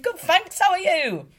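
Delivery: high, loud put-on British accent. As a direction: break into a silly British accent. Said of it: "doing my little British accent".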